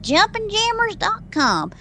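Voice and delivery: slow, high Southern drawl; Southern accent. Shown as a demonstration. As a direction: stretch it out like a Southern announcer. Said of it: "I should say that in a southern accent".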